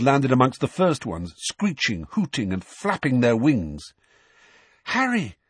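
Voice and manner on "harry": breathless, high-pitched urgent call; Hermione. A narrator's reading. Girl calling her friend amid the owls, breathless. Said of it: "said Hermione breathlessly".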